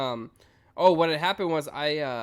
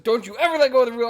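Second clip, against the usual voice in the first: loud, stern scolding; dad scolding his son at the wheel; angry dad; 8 semitones higher, 10 dB louder, faster than usual. Retold or imitated. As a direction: scold hard as a strict father. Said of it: "He was like".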